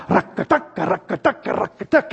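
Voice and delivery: soft rhythmic rock-a-duck creak; quiet toy creak, rising each time; rocking horse creak. Retold or imitated. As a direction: mimic the creaking horse softly. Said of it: "started to make a loud noise".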